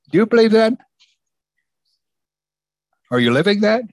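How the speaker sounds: loud pointed challenge; challenging call. Real, not acted. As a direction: call it out to the pews. Preacher challenging congregation.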